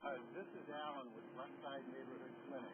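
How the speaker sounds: faint, distant over a bad line; like a crackly call from far away; weak phone connection. Real, not acted. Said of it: "The connection is weak".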